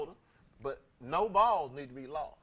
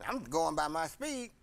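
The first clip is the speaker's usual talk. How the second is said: usual speaking voice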